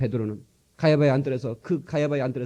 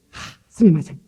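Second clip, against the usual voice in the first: a loud sigh-led sumimasen, imitating a shopkeeper; bow it out like a Japanese clerk saying "스미마센" apologetically, louder; Japanese apology; 3 semitones higher, 9 dB louder, slower than usual. Preacher imitating a Japanese shopkeeper apologizing, loud mock sorry. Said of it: "미안합니다".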